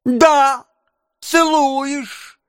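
high-pitched, insistent objection; whiny retort. A narrator's reading. Noah contradicting Charlotte's reproach, whiny retort. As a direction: argue back in a high whine. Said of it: "возразил Ноэ".

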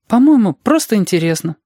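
put-on high, thoughtful child voice; sighing child. A narrator's reading. Reshka explaining what interests him, sighing child voice. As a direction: sigh it out in a child's high pitch. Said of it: "вздохнул Решка".

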